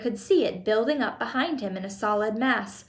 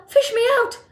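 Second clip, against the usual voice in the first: very high, loud squeal; yelled; 13 semitones higher, 7 dB louder than usual. A narrator's reading. Drowning boy to his parents, squealed yell. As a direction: yell desperately for rescue. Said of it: "he yelled".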